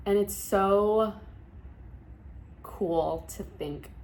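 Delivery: slow, words catching in the throat; fighting back tears as it hits you; choking up. Real, not acted. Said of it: "Why am I getting emotional?".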